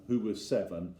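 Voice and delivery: ordinary delivery, nothing marked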